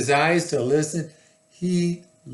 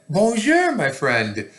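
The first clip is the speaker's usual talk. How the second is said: high, loud bonjour, easing back; lightly voiced friendly lion hello; happy lion greeting; 6 semitones higher, 4 dB louder than usual. A narrator's reading.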